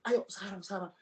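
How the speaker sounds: mildly imitated pressure to act now; pushy urging. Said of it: "maksa gitu loh".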